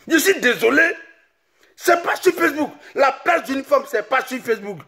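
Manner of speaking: a comic tirade shouted high; ranting shout. Acted. Ranting at women on Facebook.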